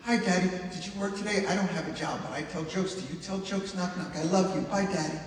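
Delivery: put-on a touch of an excited little kid greeting dad; little girl chatter. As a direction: chirp it like an eager small girl at the door. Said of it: "she'd be like".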